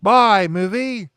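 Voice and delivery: hammed-up British accent, loud at the start; Hayley Mills accent. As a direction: put on a bad British accent, drawn out at the end. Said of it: "I apologize for the accent".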